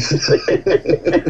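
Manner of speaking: relieved laugh, pitched high. Real, not acted. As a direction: burst out laughing after the prank reveal. Prank revealed, customer laughs.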